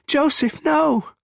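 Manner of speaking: high, loud cry of alarm; screaming. A narrator's reading. Onlooker screams at Joseph as the shooting happens, screaming. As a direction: scream to stop him. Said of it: "There's another scream".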